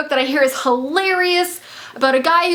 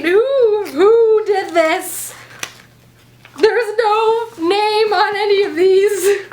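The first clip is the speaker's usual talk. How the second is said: slow, teary, overwhelmed; on the verge of happy tears; tearful awe; 5 semitones higher, 3 dB louder, much slower than usual. Real, not acted. Vlogger asking who sent the books, near tears.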